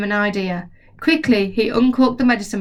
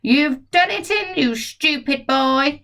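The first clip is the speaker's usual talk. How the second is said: put-on higher, slow nasty old woman's jeer; grandma scolding; 6 semitones higher, slower than usual. Grandma shouting down at George about the hen. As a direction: scold the boy with glee. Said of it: "Grandma shouted".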